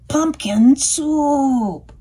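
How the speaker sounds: slow, loud savoured words; slow loud pumpkin soup; drawn-out title. A narrator's reading. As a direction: stretch it out like a treat. Storybook emphasis.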